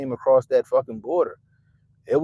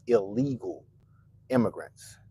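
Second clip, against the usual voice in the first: slow, leaned-on illegal with a rising tune; emphasize illegal; 2 semitones lower, 4 dB quieter than usual. Shown as a demonstration. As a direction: draw out illegal and lift it. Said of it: "You see how I emphasize illegal?".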